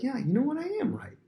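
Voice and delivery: very high-pitched righteous agreement; vindicated. Acted. Complainer feeling backed up, vindicated. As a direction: squeak it out, thoroughly convinced.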